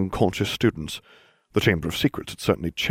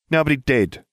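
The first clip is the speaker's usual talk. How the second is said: snapped; 7 semitones higher than usual. A narrator's reading. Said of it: "Ron snapped".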